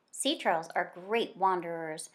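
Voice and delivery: ordinary talk, nothing marked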